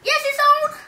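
excited insisting. Real, not acted. Kid insists camera is on.

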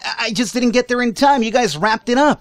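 high, pleading protest; desperate pleading. Real, not acted. Player pleading with game boss after being dismissed, high protest. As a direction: beg the boss not to cut you off.

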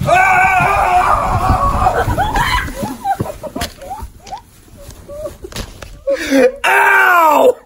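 loud yelp going down stairs; pained yell. Real, not acted. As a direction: yell out as you hit the bottom. Slides down stairs on pillow.

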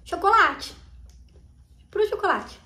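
chewing while talking; speaking behind hand at mouth; mouth full of chocolate. Real, not acted. Seen 2: Left girl chews candy, hand at mouth, while speaking.

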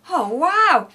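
loud, high, thrilled exclamation; grinning amazement. A narrator's reading. Shifty sees the cafe's queue, thrilled. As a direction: exclaim it through a big grin. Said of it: "grinned Shifty".

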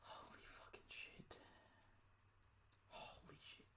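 barely audible whisper of exasperation; whispered cursing. Real, not acted. Player cursing to themselves after losing it, whispered. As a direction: drop to a spent whisper.

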